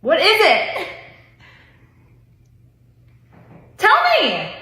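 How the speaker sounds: impatient plea. Real, not acted. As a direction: beg him to spill it, fed up. Wife pleads to know trick.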